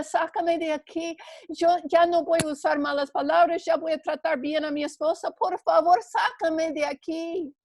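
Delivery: man hanging over a cliff, begging; desperate pleading. Acted. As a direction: plead with God in panic. Jack begging God for rescue.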